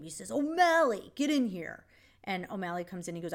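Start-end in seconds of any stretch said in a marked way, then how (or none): 0.3-1.8 retold yell. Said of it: "Alex yells his name".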